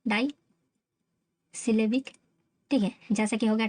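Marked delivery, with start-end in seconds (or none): 0.1-2.1 "डाईसिलेबिक" with a long pause after "डाई"; "डाई", long pause, "syllabic"; slow, broken up. Shown as a demonstration. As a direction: dictate "डाईसिलेबिक" in two slow chunks. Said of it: "उसको कहा जाता है".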